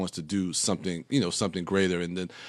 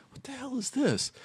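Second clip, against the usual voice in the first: high, soft puzzled exclamation; retold bewilderment; 6 dB quieter than usual. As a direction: relive the baffled first reaction, voice up but soft. Retelling his reaction to the trailer.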